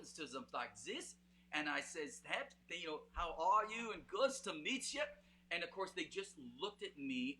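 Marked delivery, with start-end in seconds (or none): 2.2-5.0 a lightly put-on Swedish accent greeting; playful imitation of a Scandinavian accent, slightly louder. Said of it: "put on this Swedish and, or Scandinavian accent".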